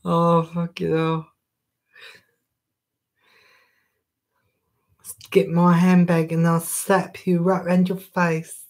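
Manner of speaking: put-on a light camp Essex accent, sassy threat; mock-slap threat in a camp Essex accent.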